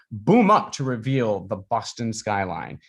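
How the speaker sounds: ordinary delivery, nothing marked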